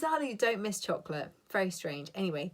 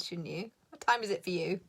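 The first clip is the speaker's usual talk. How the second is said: ordinary talk, nothing marked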